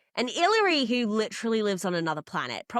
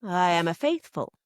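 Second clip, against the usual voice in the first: quoting Olivier; 5 semitones higher than usual. Retold or imitated. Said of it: "He said".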